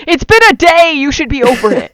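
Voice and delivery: high, loud joking protest; mock outrage. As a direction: exclaim in mock indignation. Mock outrage at "too soon.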